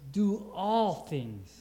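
"all" stretched and stressed, slow. Shown as a demonstration. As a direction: slowly weigh the word "all". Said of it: "not some".

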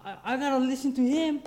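high-pitched excited exclamation; thrilled urgency. Retold or imitated. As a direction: burst out excitedly, you must take this call. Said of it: "No, it's".

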